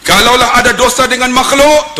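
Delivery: high, loud preaching; forceful, high-pitched proclamation; khutbah delivery. Retold or imitated. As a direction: raise your voice like a Friday preacher. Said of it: "Khutbah Jumaat".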